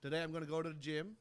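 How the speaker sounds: put-on Australian accent, careful attempt; amateur Australian accent. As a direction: try out a cheery Aussie greeting accent. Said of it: "in your best Australian accent".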